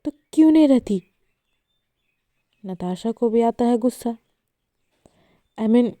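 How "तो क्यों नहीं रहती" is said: higher, louder angry yell. Acted. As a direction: blast the question at her. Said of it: "Saanvi चिल्लाकर कहती है".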